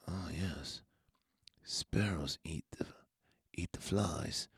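hushed, breathy madman whisper; creepy whisper. Retold or imitated. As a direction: whisper it like a secret hunger. Renfield whispers about sparrows.

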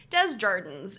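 Desjardins as "dez JAR-dins"; Ohioan pronunciation. Shown as a demonstration. Showing how Ohioans say it, Desjardins as dez-jar-dins. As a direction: say Desjardins fully English, hard J, rhyming with gardens. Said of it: "is how an Ohioan would say it".

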